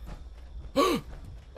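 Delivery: quick, high gasp of fright; startled gasp. Real, not acted. Player scared by a bush, startled gasp. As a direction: gasp as if something jumped out. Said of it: "Thing scared me".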